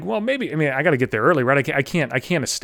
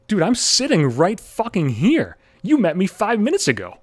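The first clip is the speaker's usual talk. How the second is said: exasperated; 9 semitones higher, 4 dB louder than usual. Retold or imitated. Exasperated inner reply.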